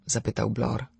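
usual speaking voice

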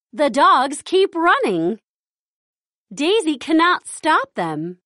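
ordinary delivery, nothing marked

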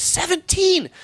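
seventeen jumps up high in disbelief; surprised. Real, not acted. Reacting to a viewer's giant shoe size, astonished. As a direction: react in disbelief to a huge number.

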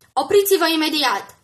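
loud commanding shout; stop command. Acted. Phil orders the pursuing crowd to stop, shouting. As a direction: halt the crowd at the gate.

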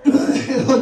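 high laugh. Real, not acted. Laughing retelling the scene.

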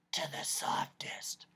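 slow, hushed whisper; barely voiced, drawn-out hush. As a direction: drop to a soft whisper. Voice acts out the word softest.

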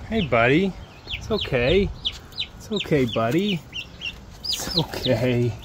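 soft, gentle reassurance; soothing a scared dog. Real, not acted. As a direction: hush him calmly, softly. Calming an escaped turkey.

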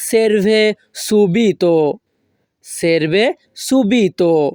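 very slow teaching pronunciation, word by word; slow model phrase. Shown as a demonstration. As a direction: model it slowly for language learners. Teacher models new phrase for learners.

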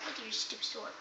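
soft taunt. Acted. Taunt repeated much softer.

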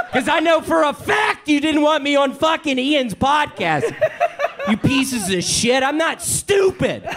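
hammed-up outrage at the producers; half-laughing mock fury; mock angry rant.

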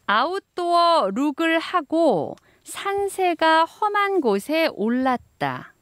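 slow, syllable by syllable dictation; over enunciating. Shown as a demonstration. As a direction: spell out every syllable slowly. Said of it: "한 문장을 또박또박 말씀드리면".

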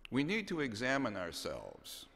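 ordinary talk

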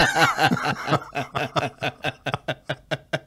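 high rolling laughter, real; long laugh. Real, not acted. Guest still laughing at his dad's story, long laugh. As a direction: keep laughing.

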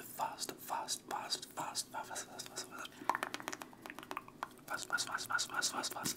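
rapid whispered fast fast fast; fast aggressive asmr. Acted. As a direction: whisper the repeats quick and punchy. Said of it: "fast and aggressive A S M R".

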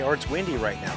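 ordinary talk

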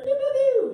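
loud, high-pitched pew-pew; mock gunshot noises. Southern guy firing pretend shots, loud high gun noises. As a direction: squeal out cartoon pistol shots.

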